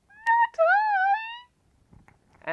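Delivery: tiny high kitten refusal; high cat voice. Acted. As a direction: squeal it way up high. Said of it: "in her high voice".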